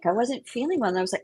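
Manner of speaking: usual speaking voice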